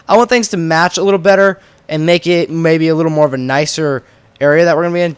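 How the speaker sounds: ordinary talk, nothing marked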